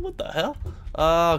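normal talking voice

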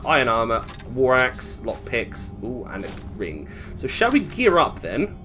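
ordinary delivery

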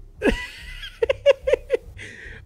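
loud shrieked cackle of surprise; shrieking laugh. Real, not acted. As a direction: burst into a screaming laugh. Phone glitch shock.

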